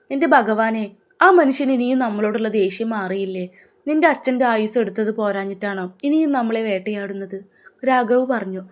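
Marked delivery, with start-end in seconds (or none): none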